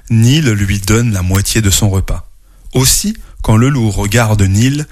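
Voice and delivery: put-on gentle read-aloud to children; storyteller reading to kids; story narration.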